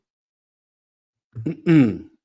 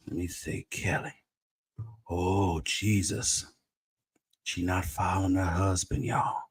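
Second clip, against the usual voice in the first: ordinary talk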